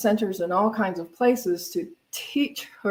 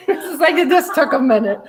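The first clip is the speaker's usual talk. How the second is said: laughing, louder and higher; 7 semitones higher, 7 dB louder than usual. Real, not acted. Guest laughing to the minister about the forgotten name. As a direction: laugh at her own forgetfulness.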